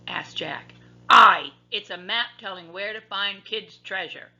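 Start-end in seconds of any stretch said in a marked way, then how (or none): 1.1-4.3 loud pirate explanation; pirate captain. A narrator's reading. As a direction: tell them about the map.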